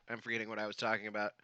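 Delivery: quiet, dazed and fast; spaced out from the burn; dazed from spicy food. Real, not acted. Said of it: "I'm getting spicy euphoria".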